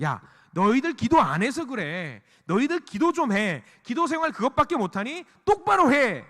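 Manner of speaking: high-pitched, emphatic telling-off; mock scolding. Imagined Jesus scolding disciples for not praying, mock-stern. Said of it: "혼내는 것과 같은".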